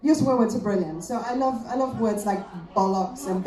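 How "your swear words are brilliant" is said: ordinary delivery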